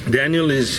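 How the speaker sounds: ordinary talk, nothing marked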